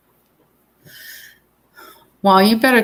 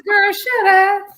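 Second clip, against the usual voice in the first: put-on high, sassy girlfriend brush-off; 13 semitones higher, 3 dB louder than usual. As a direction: fling it off with mock sass, pitched way up. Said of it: "You said".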